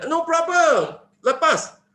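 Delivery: put-on a boy shouting an easy answer; cheeky boy. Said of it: "itu budak dia tengok itu". (suara budak yang tinggi dan kuat)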